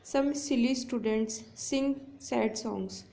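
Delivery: very slow, leaning on each s sound; slow example read. Shown as a demonstration. Teacher reading an alliteration example to students, very slow. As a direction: draw out every "s" at the start of the words. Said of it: "example देखिए".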